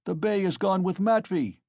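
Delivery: high, loud shout from the cart. Acted. Mikolka calling from the cart to his mates, loud shout. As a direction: call it out over the crowd. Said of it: "he shouted from the cart".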